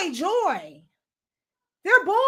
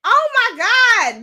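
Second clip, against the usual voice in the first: slow, louder and higher oh my God; drawn-out exasperation; 5 semitones higher, 7 dB louder, slower than usual. Real, not acted. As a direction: let the disbelief stretch. Exasperated at the clip.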